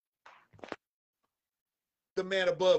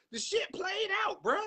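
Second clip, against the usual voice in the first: fed-up shout; 11 semitones higher than usual. Real, not acted. Ranting at reporters over a word, fed-up shout. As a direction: blow up at the overused word.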